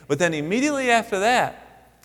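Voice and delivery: usual speaking voice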